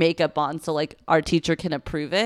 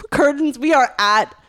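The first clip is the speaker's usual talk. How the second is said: higher, projected announcement; imitated stage manager call; 7 semitones higher, 5 dB louder, slower than usual. Stage manager before last show, high loud mock call. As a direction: call it out to the cast, drawn out at the end.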